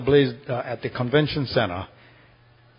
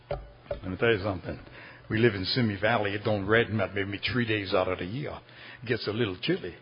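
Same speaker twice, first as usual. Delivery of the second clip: lower, soft, understated member voice; low-key retold plea; 7 semitones lower, 9 dB quieter than usual. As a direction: put the members' case gently and low. Church members pleading with pastor, retold.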